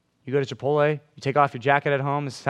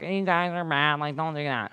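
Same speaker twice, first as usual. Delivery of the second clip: a put-on preachy jerk voice, dropping quieter; 4 dB quieter than usual. Preacher mocking a harsh friend, jerk voice. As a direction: play the judgmental jerk scolding friends. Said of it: "Didn't know [name] could make that voice".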